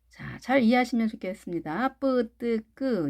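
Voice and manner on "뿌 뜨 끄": 뿌, 뜨, 끄 each tense and unaspirated; tense consonants. Shown as a demonstration. Teacher recaps for learners, tense 뿌, 뜨, 끄. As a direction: say 뿌, 뜨, 끄 with no puff of air. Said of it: "이렇게 하시면 좋겠습니다".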